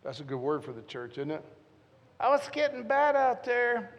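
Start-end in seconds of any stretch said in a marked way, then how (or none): 2.2-3.9 higher, louder mock fretting; mock worried voice. Preacher mimics a fretful churchgoer, higher and louder. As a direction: play a fretful churchgoer lightly.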